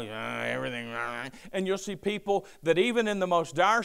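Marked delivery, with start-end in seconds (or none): 0.0-0.9 low, soft Eeyore-like mope; mock gloomy voice. Pastor mimicking negative people's mopey reply. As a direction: drop low and let it sag. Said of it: "people who are all".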